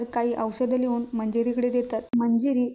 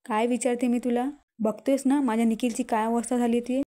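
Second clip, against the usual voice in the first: ordinary delivery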